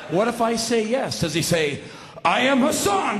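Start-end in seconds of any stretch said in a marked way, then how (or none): 2.3-3.2 loud, high mock-heroic announcement; grand proclamation. Burglar imagined declaring himself, grandly proclaimed. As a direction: proclaim the name like a genie appearing.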